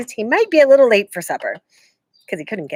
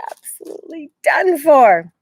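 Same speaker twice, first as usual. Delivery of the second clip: panicked; 8 semitones higher, 5 dB louder than usual. A narrator's reading. Jack despairing before the bear.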